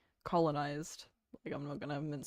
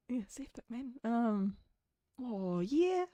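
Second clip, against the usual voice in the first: raised, put-on New Zealand accent; playfully exaggerated Kiwi accent; thicker Kiwi accent; 4 semitones higher, slower than usual. Shown as a demonstration. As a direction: try a broad, casual Kiwi accent. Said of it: "make a Kiwi accent thicker".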